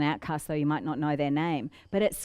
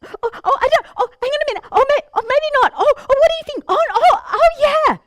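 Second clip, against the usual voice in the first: high, loud, rushed flustered ohs; flustered hesitant; 11 dB louder than usual. Shown as a demonstration. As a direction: stumble over every word, high and panicky. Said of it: "middle pauses".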